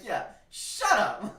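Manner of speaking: loud laughing mock yell; half-laughing shouted jab. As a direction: yell it while cracking up. Retelling, laughing.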